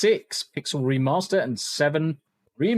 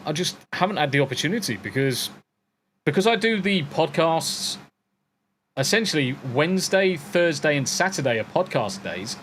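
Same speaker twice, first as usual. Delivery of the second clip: ordinary delivery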